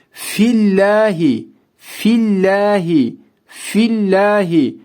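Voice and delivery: fillahi with a light, thin lam; light lam after the esre. Shown as a demonstration. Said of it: "burada da ince okudum".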